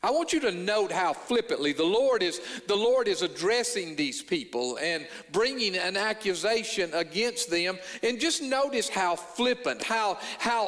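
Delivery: ordinary talk, nothing marked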